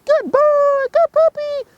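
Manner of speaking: very high, loud gushing dog praise; over-the-top high-pitched delight; happy, proud praise. Shown as a demonstration. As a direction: squeal your praise to a puppy. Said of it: "completely change your demeanor into a happy, proud moment".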